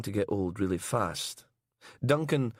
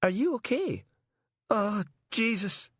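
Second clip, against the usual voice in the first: gagging; 10 semitones higher than usual. Acted. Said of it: "Mister New was spitting, gagging".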